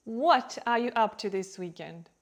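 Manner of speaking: loud, leading "What"; stress on WHAT. Shown as a demonstration. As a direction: punch the first word "What". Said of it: "Also compare".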